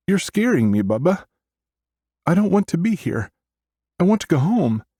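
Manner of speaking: put-on very high, frightened child voice; scared small sister pleading, about to break down; little girl near wailing. A narrator's reading. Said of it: "she starts to wail".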